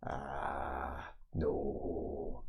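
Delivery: a low, quiet, unimpressed aside; a deep, muttered brush-off; gruff NPC. Acted. GM voices a sceptical NPC.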